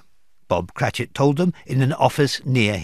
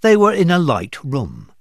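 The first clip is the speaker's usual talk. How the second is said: usual speaking voice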